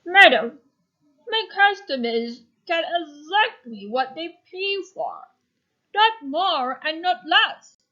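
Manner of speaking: lightly voiced firm baker, slowing at the end; baker's voice. Shown as a demonstration. Baker refusing the old woman firmly. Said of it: "Remember to change your voice".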